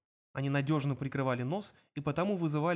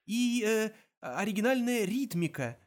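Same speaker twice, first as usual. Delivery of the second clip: improvised praise; 7 semitones higher than usual. A narrator's reading. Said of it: "продолжал Артур".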